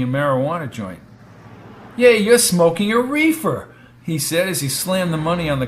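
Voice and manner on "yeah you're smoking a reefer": loud, high accusation; accusing. Acted. Brady confronting the smoking attendant, accusing. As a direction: call him out sharply.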